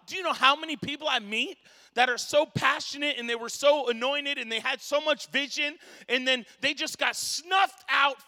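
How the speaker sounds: loud, rising, slowed on the last lines; preaching shout. Real, not acted. Preacher shouting to the congregation about the snuffed-out anointed. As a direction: hammer the point home to the crowd.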